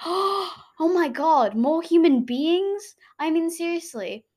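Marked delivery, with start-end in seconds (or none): 0.0-3.0 slow, loud, high amazement; mock astonished. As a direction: drag it out in fake shock. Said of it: "gasp really loudly and say".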